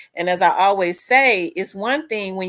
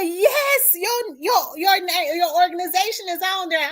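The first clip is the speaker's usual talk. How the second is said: much higher, eager confirming; excited friend; 12 semitones higher than usual. Retold or imitated. Friend assuring her the organization is listed, high and excited. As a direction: insist happily it's true.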